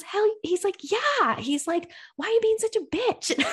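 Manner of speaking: high mock voice. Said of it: "this other guy comes in and he goes".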